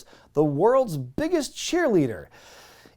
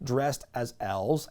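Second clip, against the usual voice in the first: ordinary talk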